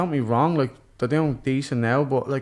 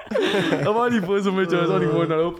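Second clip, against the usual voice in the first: laughing; 5 semitones higher than usual. Retold or imitated. Laughing as he tells his missus he was only winding her up.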